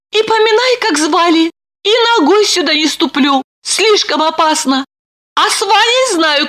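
high-pitched scheming heroine; high, anxious-but-decided inner monologue; Lipochka, resolving. A narrator's reading. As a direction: think out your escape in a high, lively voice.

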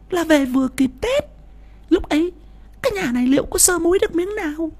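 greedy wife hissing a sharp high retort; snapping wife. Acted. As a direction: snap at the husband in a high shrewish voice.

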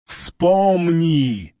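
distorted roaring monster voice; eldritch horror. A narrator's reading. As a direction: roar it like a warped creature. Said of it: "ревел искажённый голос".

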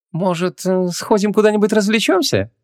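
high-pitched tentative invitation; Ванесса, suggesting. Acted. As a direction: float the idea in a female character voice. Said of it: "неожиданно предложила Ванесса".

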